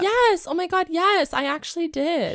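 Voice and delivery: high, loud burst of remembering; excited recognition. Real, not acted. Host recognises the old cartoon show, high and excited. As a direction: exclaim as it suddenly comes back.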